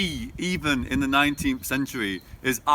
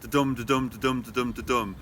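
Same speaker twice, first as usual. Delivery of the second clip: da-dum in even pulses, each DUM stressed; iambic beat; 2 semitones lower than usual. Shown as a demonstration. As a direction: tap out da-dum weak-strong, dropping at the end. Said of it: "five I ams per line".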